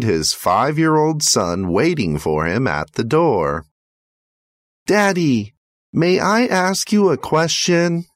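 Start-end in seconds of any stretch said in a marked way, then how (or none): 4.9-8.1 high, lightly voiced small boy asking sweetly; little boy. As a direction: play a hopeful five-year-old asking his dad. Five-year-old son greets father.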